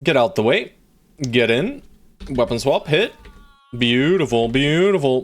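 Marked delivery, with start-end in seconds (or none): none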